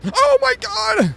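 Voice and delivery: high, drawn-out startled shriek; scared scream. Real, not acted. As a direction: jump out of your skin. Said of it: "Who got scared worse".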